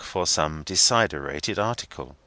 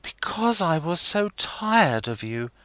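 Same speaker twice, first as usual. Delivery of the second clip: put-on young girl Rosa; 11 semitones higher than usual. A narrator's reading. Rosa voiced in story.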